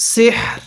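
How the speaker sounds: short সিহর, full h and faint r; pausal ending. Shown as a demonstration. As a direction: breathe out the h, barely touch the r. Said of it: "রটা হালকা উচ্চারণ হবে". (সিহর-এ হা পরিপূর্ণ, র হালকা)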